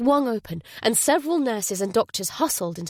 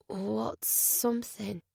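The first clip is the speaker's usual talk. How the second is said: fearful whisper; 9 dB quieter, slower than usual. Acted. Woman fearing hidden loss.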